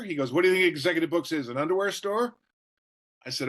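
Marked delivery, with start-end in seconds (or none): 0.3-2.3 quick, high-pitched incredulous comeback; mentor's mock retort. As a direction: imitate a mentor firing back a joking question.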